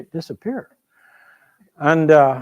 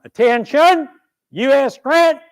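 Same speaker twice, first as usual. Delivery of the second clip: high, loud parade-ground command; drill sergeant yell; 10 semitones higher, 7 dB louder than usual. Acted. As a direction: call the cadets to attention at full volume. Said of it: "the drill sergeant yells out".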